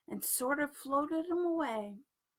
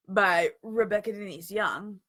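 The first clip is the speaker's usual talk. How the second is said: ordinary delivery, nothing marked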